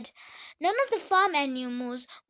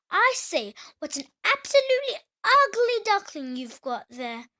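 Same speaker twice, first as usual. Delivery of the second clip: higher, louder snooty duck; a grand duck sneering at the duckling; scornful big duck; 6 semitones higher, 5 dB louder than usual. Acted.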